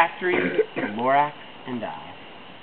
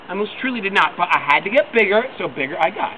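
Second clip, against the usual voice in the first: usual speaking voice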